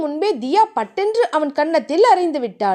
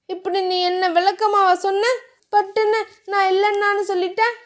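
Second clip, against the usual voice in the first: higher, pouting complaint; mild lover's huff; sulking anger; 6 semitones higher than usual. Acted. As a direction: sulk and pout at him. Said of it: "கோபித்து கொண்டாள்".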